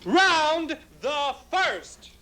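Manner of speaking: slow, drawn-out ringside call; announcer. Acted. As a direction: call the round like a referee. Announcing the round.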